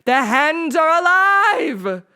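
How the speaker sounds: high, loud, slow triumphant cry; crazed teacher exulting; ecstatic shout. A narrator's reading. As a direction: proclaim it like a madman. Teacher in excited frenzy.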